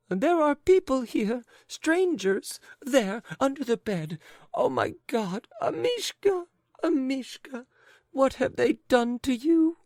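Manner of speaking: high, loud sobbing wail. Acted. Lady wailing over her dead dog, high and sobbing.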